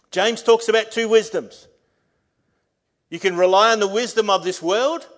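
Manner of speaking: loud, high proclamation of the promise; full-force preaching. Acted. Pastor reading God's promise to the congregation, preaching at full force. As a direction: declare every line over the church.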